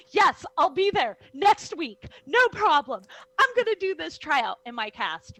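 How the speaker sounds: loud, high, eager acceptance; excited. Retold or imitated. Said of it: "I was just so excited".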